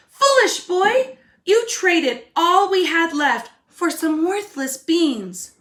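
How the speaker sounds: high, loud, slow scolding; scolding mother. Acted. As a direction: scold him like a furious mother. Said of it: "his mother said".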